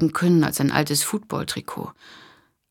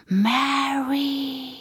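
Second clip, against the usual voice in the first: high, drawn-out spooky call; ghostly call; 10 semitones higher than usual. Acted. Voice from the closet calls the name high and eerie. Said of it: "gespenstisches Flüstern".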